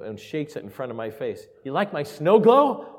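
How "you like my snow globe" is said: put-on high, loud, slow little-girl question; excited child. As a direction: ask it like an eager kid waking Dad. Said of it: "and she go".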